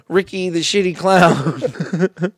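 slow, laughing through the name. Real, not acted. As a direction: crack up while saying it. Laughing joke clown name.

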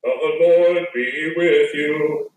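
ordinary talk, nothing marked

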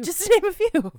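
mocking echo. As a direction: parrot it back grandly.

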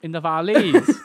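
loud laughing mock Welsh; Welsh accent. Friend echoing the Welsh guess, loud mock Welsh. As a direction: put on Valleys Welsh, amused. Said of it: "when you do a Welsh accent".

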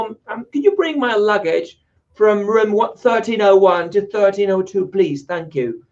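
slow, careful tourist English request; slow polite English. Retold or imitated. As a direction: ask the concierge politely and slowly. Asking concierge in English.

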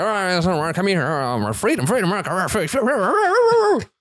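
high, garbled mock American accent; American accent impression. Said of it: "That's my American accent impression".

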